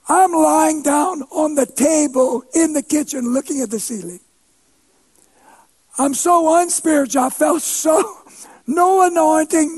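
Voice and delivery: ordinary talk, nothing marked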